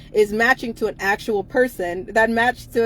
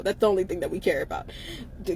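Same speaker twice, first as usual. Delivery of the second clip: normal talking voice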